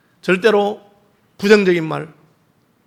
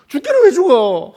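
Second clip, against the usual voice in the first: higher, slowed, drawn-out retort; acted-out dismissal of the crowd's despair; defiant rebuttal; 5 semitones higher, 3 dB louder, slower than usual. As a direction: push back against the panic with a raised pitch.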